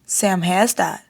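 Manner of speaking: usual speaking voice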